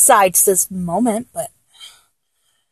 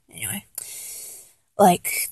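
ordinary delivery

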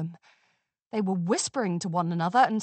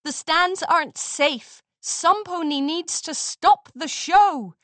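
high, slow, louder call; yelling back; 7 semitones higher, 5 dB louder than usual. A narrator's reading. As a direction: shout back over the noise. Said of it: "Amber Moon yelled back".

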